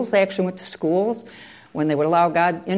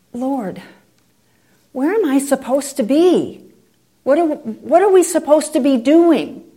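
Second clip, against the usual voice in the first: higher pleading prayer; a hint of a searching prayer; praying Ken; 7 semitones higher, 4 dB louder than usual. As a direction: plead with the Lord, lifting up.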